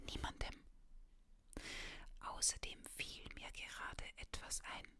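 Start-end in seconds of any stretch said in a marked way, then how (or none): none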